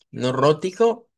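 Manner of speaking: ordinary talk, nothing marked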